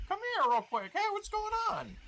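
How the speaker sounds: very high squeaky goblin. Acted. Goblin calls to the hidden players, very high and squeaky. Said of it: "They're like".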